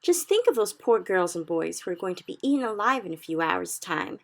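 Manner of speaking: loud, high, desperate outburst; cried out. A narrator's reading. Sophie pleading loudly with the BFG to act. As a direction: cry out in distress for the children. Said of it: "she stood up and cried out".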